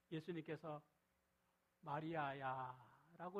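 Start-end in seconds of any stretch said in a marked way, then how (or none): 1.8-3.4 slow, drawn-out loving call of her name; tender calling. Shown as a demonstration. Jesus calls weeping Mary by name, tender and slow. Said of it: "이렇게 부르시니까".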